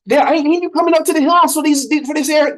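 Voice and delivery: high-pitched, hammed-up hillbilly prospector accent; terrible put-on accent. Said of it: "my accents are terrible".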